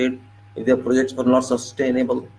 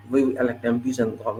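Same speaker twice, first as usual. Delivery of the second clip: ordinary talk, nothing marked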